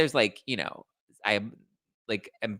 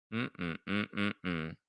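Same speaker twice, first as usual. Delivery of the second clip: soft hum; 4 semitones lower, 9 dB quieter, much slower than usual. Real, not acted. Humming to herself.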